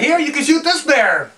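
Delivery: hammed-up flustered hunting guide. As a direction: blurt it out high to placate an angry boss. Flustered guide offers cub to president.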